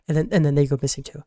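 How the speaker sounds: ordinary delivery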